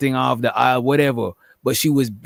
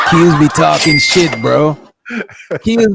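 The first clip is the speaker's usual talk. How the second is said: laughing shout; 7 dB louder than usual. Real, not acted. Laughing while calling out friend.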